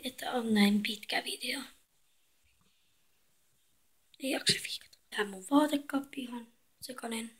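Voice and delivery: soft apologetic whisper. Real, not acted. Girl whispers an apology to viewers for the messy wardrobe. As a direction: whisper it, voice giving out. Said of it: "puhun näin hiljaa".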